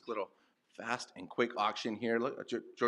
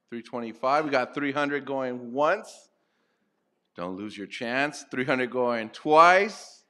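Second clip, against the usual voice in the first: loud auction calling, slowing right down on going once, going twice; closing the final lot, louder and louder to sold; auctioneer impression; 3 semitones higher, 9 dB louder than usual. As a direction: draw out the countdown, then bang out sold. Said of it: "my best impression of an auctioneer".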